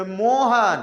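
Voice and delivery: Mohan slow, leaning on मो; stress on मो. Shown as a demonstration. Teacher illustrating stress with the name Mohan, stressed मो. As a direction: stretch and push the first syllable मो of Mohan. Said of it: "तो म पर ज्यादा लग रहा".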